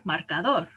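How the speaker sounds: "marcador" as mar-ca-DOR; last-syllable stress. Shown as a demonstration. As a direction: settle the stress on the end of "marcador". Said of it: "I don't say marcador or marcador".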